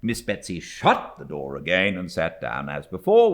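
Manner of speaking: usual speaking voice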